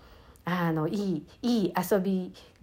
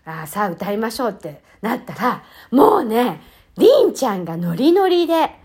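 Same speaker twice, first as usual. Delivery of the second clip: ordinary talk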